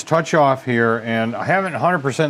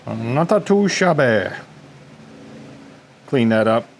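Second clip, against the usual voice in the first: playful put-on voice. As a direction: say it in a silly voice. Playful self-praise.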